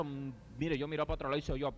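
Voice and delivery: ordinary delivery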